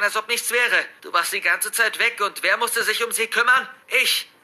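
accusing outburst, rising in pitch and force; impassioned shouting. Acted. Son blaming his father for Marie, impassioned shouting. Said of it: "schreie ich keuchend".

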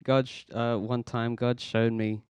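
usual speaking voice

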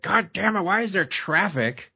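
put-on half-hearted retelling of a boy swearing at traffic; lightly acted angry outburst; mock angry kid. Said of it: "aggressive and angry".

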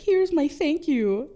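high, loud, slow doggy voice; cutesy grateful dog. Retold or imitated. Dog offers boys its hoard of baseballs, cutesy grateful voice. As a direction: present the gift in a high, cute voice.

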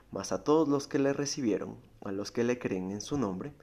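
rising to a loud high peak on hechos; scripture reading. Acted. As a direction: build the verse up to a loud cry. Reads scripture aloud. (lectura bíblica que culmina en un grito)

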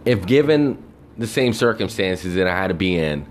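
normal talking voice

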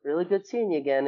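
high imitation of friends' fond greeting; retold warm hello, pitched well up; friendly affection. Friends greeting him that Monday, imitated high and fond. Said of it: "And they're just like".

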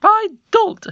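high, loud retort; shrill-free sharp denial, loud; angry queen voice. A narrator's reading. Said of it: "said the queen".

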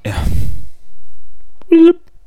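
loud breathy sigh; exasperated sigh. Real, not acted. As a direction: sigh at the slip. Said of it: "sighs at own misread".

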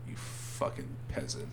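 whispered mock insult.

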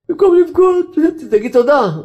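high, loud preaching cry; a preacher crying out to the congregation; fervent exhortation. Acted. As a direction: rouse them to give thanks instead of crying. Preacher urging crowd to thank.

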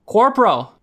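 high, loud summons; calling out. A narrator's reading. Sentry summoning the corporal, calling loudly. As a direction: call for a superior across the camp.

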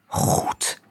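voiceless whispered assent. A narrator's reading. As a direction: whisper it, all breath. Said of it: "fluisterde Thijs".

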